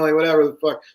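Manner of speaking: normal talking voice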